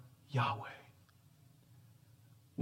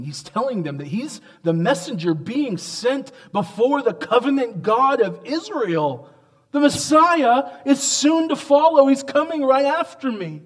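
much higher and louder, rising to a climax; full-force preaching; 10 semitones higher, 7 dB louder than usual. Real, not acted. Preacher telling the congregation the Messiah is coming, at full force. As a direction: build to a triumphant cry.

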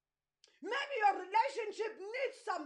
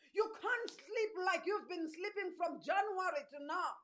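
usual speaking voice

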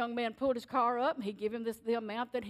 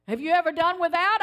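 louder, pointed question to his wife; pointed challenge; 5 semitones higher, 8 dB louder than usual. Retold or imitated. Husband challenging his worried wife, louder and pointed. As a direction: press her with it.

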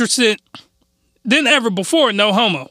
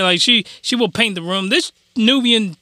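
ordinary talk, nothing marked

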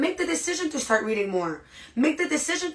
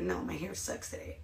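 low-volume, dejected self-talk; private negative thought; 3 semitones lower, 13 dB quieter than usual. Acted. Looking in the mirror at their hair, privately dejected. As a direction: say it quietly to yourself. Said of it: "still gonna think in your head".

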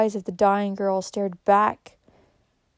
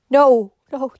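loud, higher interruption; alarmed refusal; 4 semitones higher, 9 dB louder than usual. A narrator's reading. Kate forbids sending for her parents, alarmed. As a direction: burst in with distressed protest.

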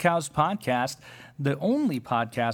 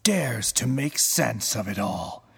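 movie trailer narrator; 5 semitones lower than usual. Acted. Trailer narration ending in whisper.

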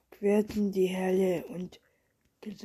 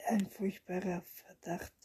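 hushed storyteller's whisper throughout; whispered story reading; 3 dB quieter than usual. A narrator's reading. Reading a bedtime story aloud, whispered. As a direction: read the tale under your breath.

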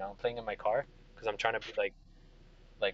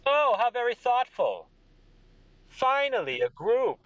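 high, loud gushing king; delighted king; 13 semitones higher, 6 dB louder than usual. Acted. King greeting the adventuring group, delighted. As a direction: gush as the king, pitched way up. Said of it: "and he goes".

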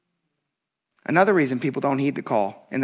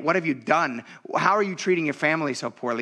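usual speaking voice

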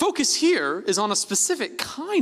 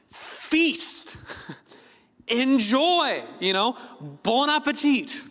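high, lavish voice of a generous host urging a feast; God urging a feast; 7 semitones higher, much slower than usual. Retold or imitated. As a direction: play God inviting Adam to dig in, delighted. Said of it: "It's like God is saying to Adam".

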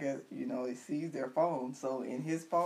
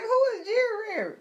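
high squeal of laughing disbelief; 3 dB louder than usual. Retold or imitated. Host retelling her reaction, laughing high-pitched question. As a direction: crack up asking it. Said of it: "I was like".

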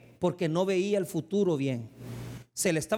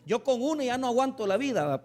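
ordinary talk

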